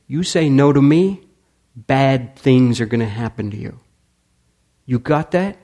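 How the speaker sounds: loud menacing threat. Retold or imitated. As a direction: warn him that bad things will happen. Thug threatens old man.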